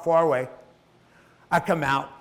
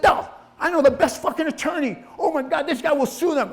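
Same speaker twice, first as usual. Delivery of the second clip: high-pitched indignant outburst, acted out; outraged brother; 11 semitones higher, 3 dB louder than usual. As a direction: fire up like a brother ready for a legal fight. Brothers outraged on speaker's behalf.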